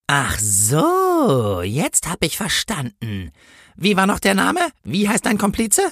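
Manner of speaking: slow dawning realisation, then high questioning; knowing policewoman. A narrator's reading. Policewoman thinks she understands, slow and knowing, then interrogates. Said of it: "als würde ihr ein Licht aufgehen".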